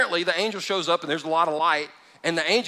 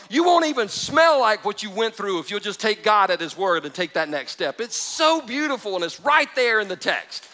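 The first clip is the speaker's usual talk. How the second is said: higher, louder, fervent preaching; preaching at full force; 8 semitones higher, 4 dB louder than usual. Acted. Preacher rouses congregation about chains falling, fervent and loud. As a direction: fire up the room with joy.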